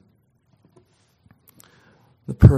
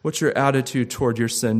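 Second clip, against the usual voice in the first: ordinary delivery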